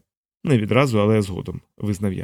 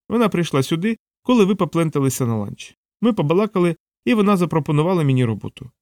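higher-pitched voice of a reasoning assistant; Savitri, sharp-witted assistant; 5 semitones higher than usual. Acted. Assistant explaining her hiring to her boss, reasoned higher voice. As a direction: pitch it up, laying out the logic.

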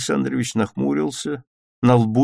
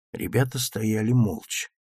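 ordinary delivery, nothing marked

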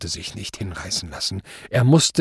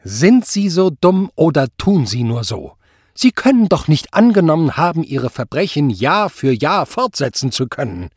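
high, loud questioning; scornful judge at raised volume; exasperated berating; 5 semitones higher, 6 dB louder than usual. A narrator's reading. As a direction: hammer him with disbelief.

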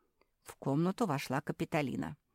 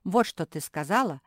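loud, hurt outburst, lightly acted; mock sobbing; 6 semitones higher, 9 dB louder than usual. A narrator's reading. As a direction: accuse loudly with a touch of tears. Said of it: "всхлипнула мопсишка".

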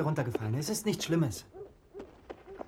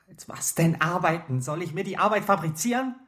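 raised, indignant questioning; exasperated retort; 4 semitones higher, 5 dB louder than usual. Acted. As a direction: throw it back at mother, louder. Son argues back at mother. (aufgebracht und gereizt)

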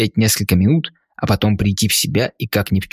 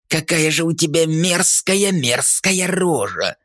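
higher, slowed gurgling sneer; mocking ghoul drawl; 7 semitones higher, slower than usual. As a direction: stretch мерзкая рожа in a gloating ghoul drawl. Ghoul repeats insult, more mocking.

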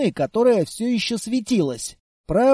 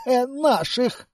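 strained, gasping through coughs; coughing; 3 semitones higher than usual. Acted. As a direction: choke out the word. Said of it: "просипел чистильщик".

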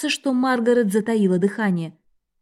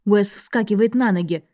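ordinary delivery, nothing marked

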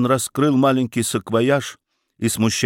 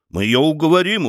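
уверенно; 5 semitones higher, 3 dB louder than usual. A narrator's reading. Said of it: "уверенно произнёс бургомистр".

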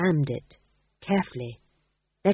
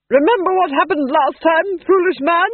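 loud, high-pitched haughty rebuke; scornful duchess; 11 semitones higher, 9 dB louder than usual. A narrator's reading. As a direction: snort it at a foolish husband. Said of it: "The Duchess snorted".

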